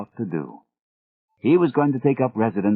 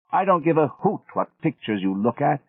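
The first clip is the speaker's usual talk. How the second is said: put-on Gregory's British accent; 5 semitones higher than usual. A narrator's reading. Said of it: "in that British accent of his".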